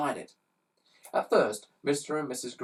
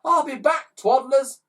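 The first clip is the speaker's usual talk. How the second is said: calling out; 13 semitones higher, 9 dB louder than usual. Acted. Ned calling while zooming off.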